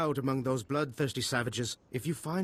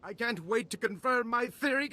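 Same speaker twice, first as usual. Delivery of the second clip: eager anticipation; 9 semitones higher than usual. Acted. Scientist receives his research.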